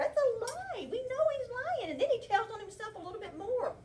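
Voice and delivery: high, loud gotcha; teacher thrilled the class spotted the lie; excited. Real, not acted. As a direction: exclaim it like you've caught him. Teacher exclaims, catching the lie.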